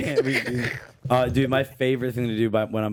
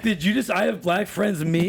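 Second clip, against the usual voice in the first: mocking friend; 7 semitones higher than usual. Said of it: "he was like".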